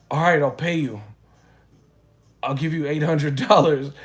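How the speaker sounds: mock a guy suddenly offering cash; loud desperate offer. Retold frantic guy bargaining.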